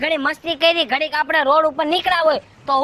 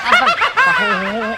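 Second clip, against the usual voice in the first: laughing cry; 7 semitones higher, 4 dB louder, much slower than usual. Acted. Husband laughing while fleeing.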